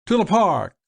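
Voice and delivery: high, loud command to the driver; ordering. A narrator's reading. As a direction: bark the destination grandly. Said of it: "ordered Malvolio".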